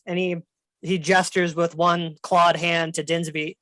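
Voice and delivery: ordinary delivery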